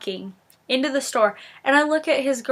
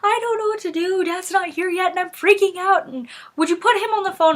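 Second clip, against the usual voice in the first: high-pitched, mock panicked pleading; hammed-up near-tears panic, not a real scream; retold frantic sister; 8 semitones higher than usual. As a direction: imitate a sister freaking out, voice pitched way up. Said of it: "about in tears and screaming".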